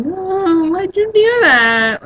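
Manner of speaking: high, drawn-out mock whine; whining. Mocking a boyfriend punched in the arm, whining. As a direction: imitate a wimp whining in slow motion. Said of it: "start whining".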